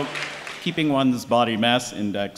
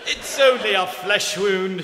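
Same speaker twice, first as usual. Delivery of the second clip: slow, higher mock British delivery; Monty Python British; 5 semitones higher, slower than usual. Host quoting Monty Python in a hammed-up British accent. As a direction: play the wounded knight, upbeat and slow. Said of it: "I break into Monty Python".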